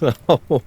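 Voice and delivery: sharp, cruel burst of laughter; loud mocking laugh. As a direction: laugh at the captive's request. Mocking request to eat.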